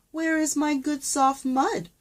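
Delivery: high, squeaky little pig asking; small pig. A narrator's reading. The small pig asks the farmer, high little pig voice. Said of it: "asks the small pig".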